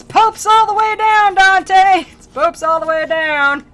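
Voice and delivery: high, loud comic wail; over-the-top shouting to someone above; mock despairing shout. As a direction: holler it in hammed-up exasperation. Pope's acted cry to Dante.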